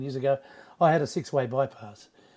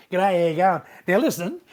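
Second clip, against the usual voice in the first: mock Aussie greeting; 2 semitones higher, 6 dB louder than usual. Mimicking his own brash TV manner.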